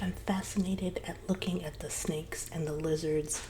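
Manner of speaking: ordinary delivery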